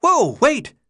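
high, loud cry of alarm. Acted. Chuck crying out as Thomas heads for the walls, high alarmed call. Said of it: "Chuck cried".

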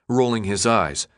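ordinary delivery

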